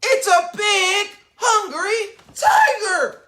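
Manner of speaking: high, loud, dragged-out tiger exclamation; a boy shouting that a tiger has come; excited cry. A narrator's reading. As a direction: cry out with childlike thrill.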